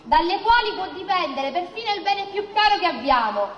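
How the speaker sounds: ordinary talk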